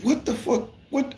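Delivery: sharp high-pitched what the fuck; shocked disbelief. Retold or imitated. Stunned by the boss's hint about the police, high and incredulous.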